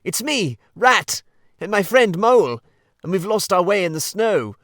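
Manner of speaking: high, loud begging through the door; pleading call. Acted. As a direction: beg to be let in, loudly. Rat begs at the door.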